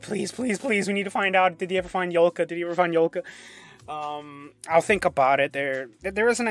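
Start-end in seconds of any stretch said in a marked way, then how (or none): 0.0-3.2 imitating pleading fans, higher and louder; eager fans. Voice actor mimicking fans begging for answers, eager higher-pitched pleading. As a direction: plead like an impatient fan crowd. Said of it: "a lot of the fans are like".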